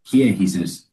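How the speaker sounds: ordinary talk, nothing marked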